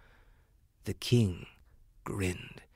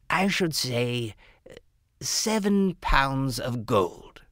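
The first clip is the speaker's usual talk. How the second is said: formally addressing royalty; 3 semitones higher, much slower than usual. Acted. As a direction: weigh each word before the king.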